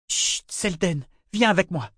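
short-tempered motherly command; curt. Acted. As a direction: hush the child and order him along. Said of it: "lui dit-elle d'un ton sec".

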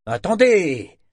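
loud, high, falling call to halt; almost angry exclamation. A narrator's reading. Agent stopping the departing job-seeker, almost angry. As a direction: call him back with a near-angry burst. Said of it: "s'exclama-t-il presque avec colère".

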